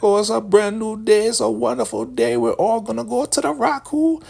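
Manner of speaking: giddy elder woman. Retold or imitated. Elder woman gleefully announcing the Raku to everyone, high giddy voice. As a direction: chirp it like a thrilled believer.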